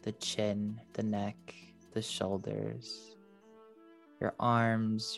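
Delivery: slow, fading soft body scan; airy breathy mystical healer. Acted. Leads a meditation.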